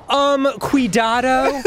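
put-on high, slow Valley Girl Spanish; Valley Girl accent. Shown as a demonstration. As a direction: drawl cuidado like a Valley Girl. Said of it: "Valley Girl style English accent".

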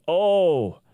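loud, high cry of "Oh"; imitated shout. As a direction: shout it out as if knocked backwards. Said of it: "fell back several feet shouting".

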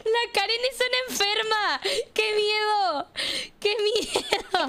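high-pitched playful alarm; hammed-up scared whine; mock fright. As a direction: wail it in pretend fear, stretching the end. Mock fright at Karen's behaviour.

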